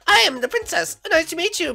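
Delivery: high, dainty princess voice. Pretend. A new princess greeting the mole in a high voice. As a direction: play the princess sweet and high. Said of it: "I'm a princess now".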